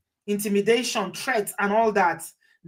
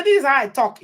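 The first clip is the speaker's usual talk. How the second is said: louder, higher quoted excuse; raised-voice mock defiance; mock shouting; 5 semitones higher, 5 dB louder than usual. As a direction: imitate someone shouting back at a judge. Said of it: "you start shouting at the judge".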